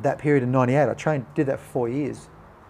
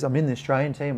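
usual speaking voice

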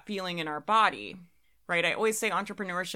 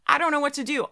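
mock panic; 8 semitones higher, 7 dB louder, faster than usual. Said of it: "They're like".

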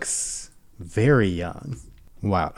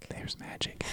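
hushed dramatic whisper; 23 dB quieter than usual. Pretend. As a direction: breathe it like a secret. Whispered tagline, pair with line 8.

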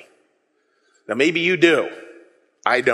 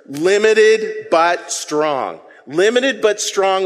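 said slowly with the crowd; drawn out, deliberate; slow call and response; much slower than usual. Shown as a demonstration. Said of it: "Let's say those three words".

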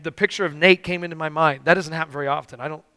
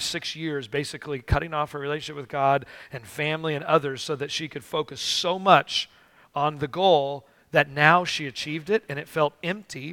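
usual speaking voice

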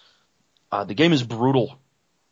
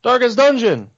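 loud, high-pitched cheer of the title; excited shout; 12 semitones higher, 8 dB louder than usual. Real, not acted. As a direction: shout it out, thrilled, pitch soaring then falling.

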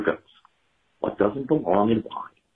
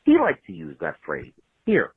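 usual speaking voice

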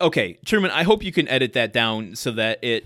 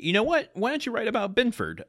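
eager dad voice; 11 semitones higher than usual. Retold or imitated. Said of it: "he also suggests to Randy".

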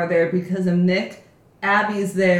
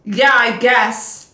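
grudging agreement; 5 semitones higher, 9 dB louder, much slower than usual. Retold or imitated. Reluctant reply to friend.

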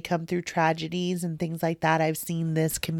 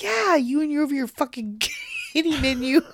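high, louder teasing put-down; mock scolding quote; 9 semitones higher, 4 dB louder than usual. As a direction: imitate a friend's scorn, pitched way up. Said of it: "And she's like".

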